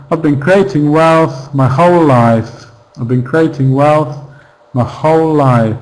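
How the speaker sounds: "I've been" clipped short, "for" dropped before "my whole life"; reduced sentence. Shown as a demonstration. Teacher modelling the sentence without the unnecessary word, reduced function words. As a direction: rush lightly through "I've been", land on "creating wealth" and "whole life". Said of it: "We might not even say this word".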